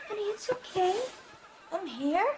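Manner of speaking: crying comfort. Acted. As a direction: comfort her through your own tears. Distressed mother comforting her child.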